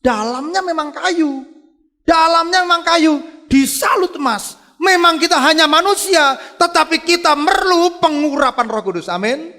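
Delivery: loud, high-pitched preacher's cry; high, ringing sermon climax; full-force preaching. Real, not acted. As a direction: proclaim it forcefully to the congregation.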